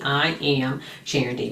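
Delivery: usual speaking voice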